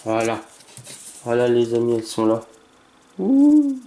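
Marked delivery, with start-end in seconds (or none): 3.2-3.8 high, loud squeal of delight; excited squeal. Real, not acted. Collector trembling over the rare cards, delighted high squeal.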